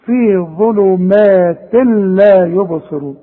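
slow ظلمات لا, tanween merged into a clean laam; measured tajweed model of ظلمات لا يبصرون; tanween unpronounced. Shown as a demonstration. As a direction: recite ظلمات لا as "zulumaatil-laa", no nasal hum. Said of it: "مش هنطق التنوين ده. اسمع".

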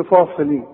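فاصلين with a dark, backed "faa"; over-thicken the first vowel of فاصلين; wrongly heavy Fa. Shown as a demonstration. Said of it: "كل دي غلط".

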